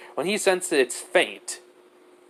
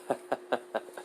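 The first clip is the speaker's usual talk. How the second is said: soft breathy chuckle; 12 dB quieter than usual. Acted. God plotting events with Cell, quiet breathy chuckle. Villainous character laughs while plotting.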